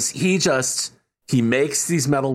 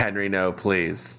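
lower, softer, totally flat plea; deadpan; 6 semitones lower, 4 dB quieter than usual. Retold or imitated. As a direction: say it like you couldn't care less. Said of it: "says in a complete deadpan".

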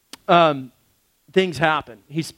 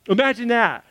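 ordinary talk, nothing marked

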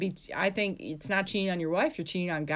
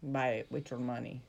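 put-on low, soft French-Canadian accent; mock French-Canadian accent, kept quiet; 8 semitones lower, 9 dB quieter than usual. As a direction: lightly imitate a French-Canadian. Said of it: "Yeah, your French accent".

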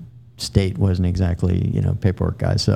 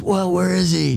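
lightly voiced gruff sergeant, pitched higher; demanding sergeant; 10 semitones higher than usual. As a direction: bark the question like an impatient sergeant. Sergeant demanding the missing captain.

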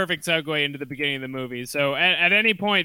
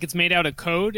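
ordinary talk

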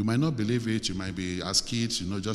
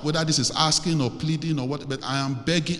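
pleading prayer; 3 semitones higher, 3 dB louder than usual. Acted. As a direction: lead them in an urgent, imploring prayer. Leads congregation in pleading prayer.

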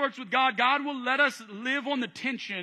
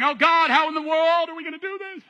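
higher, louder worried cry; mock panic; 7 semitones higher, 6 dB louder than usual. Person in life's battles panicking to God. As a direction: throw up your hands in alarm. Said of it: "do you find yourself going".

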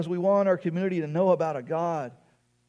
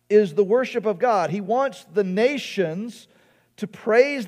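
raised, louder preacher's voice; impassioned preaching; 6 semitones higher, 7 dB louder than usual. Real, not acted. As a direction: preach it high and emphatic. Preaches with rising force.

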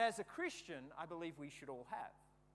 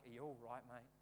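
softer, lower, gently concerned; compassionate; 7 semitones lower, 6 dB quieter than usual. Retold or imitated. To the hurt boy, a soft concerned check. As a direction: pat his back and ask softly. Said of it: "My compassionate side came out".

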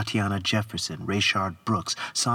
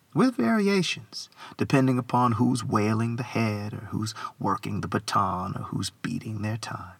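ordinary talk, nothing marked

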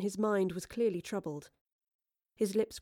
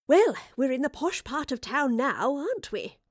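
bright, higher, chirping remark; chirpy; 6 semitones higher, 3 dB louder than usual. Acted. Professor to Astrid entering posh part, chirpy. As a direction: chirp it cheerfully. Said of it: "the Professor chirped".

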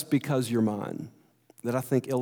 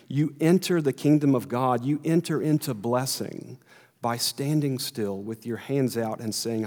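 ordinary talk, nothing marked

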